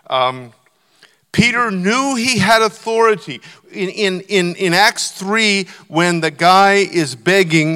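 rising pulpit fervour; full-force preaching. Real, not acted. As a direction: preach it out to the back row.

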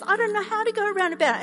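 panicky daughter. Retold or imitated. Daughter to dad at the roundabout, rushed and panicky. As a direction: rush it out, lost at the wheel.

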